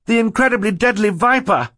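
very high, loud scream of warning; alarmed cry. A narrator's reading. Boy warning adults about the snake, high alarmed cry. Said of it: "Klaus cried".

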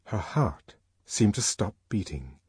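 ordinary delivery, nothing marked

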